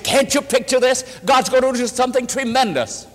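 high, emphatic exhortation; retold rousing promise; fervent encouragement. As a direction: urge her on with conviction. Preacher exhorts Gladys with hope.

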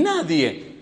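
ordinary talk

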